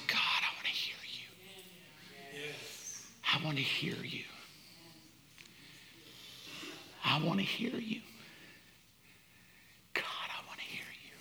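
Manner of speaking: hushed, breathy whisper, barely voiced; whispered prayer. Mother praying alone in the closet, whispered plea. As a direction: whisper a private plea to God, with long pauses.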